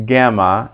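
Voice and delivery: "gamma" with G as in give; hard G. Shown as a demonstration. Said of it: "G is always hard".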